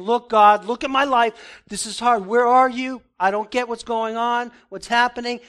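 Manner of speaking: urgent call for help; desperate plea. Retold or imitated. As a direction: cry out to God in despair. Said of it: "he's saying".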